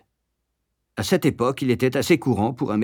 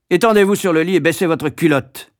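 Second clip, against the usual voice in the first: loud, raised, brusque command; ordering; 7 semitones higher, 6 dB louder than usual. A narrator's reading. Matron about to examine the boy, ordering. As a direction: issue it like a drill order. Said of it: "ordonna-t-elle".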